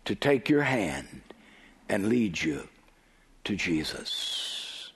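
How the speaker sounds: low voice sinking into a whisper; hushed, gentle invitation. Acted. Law offering to lead a sinner to Jesus, hushed. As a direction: let it fade softly, phrase by phrase.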